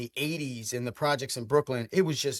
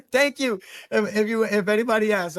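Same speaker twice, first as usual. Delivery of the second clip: high, loud laughing thanks; 6 semitones higher, 5 dB louder than usual. Real, not acted. Joking back at the host, laughing loudly. Laughing after host's height joke.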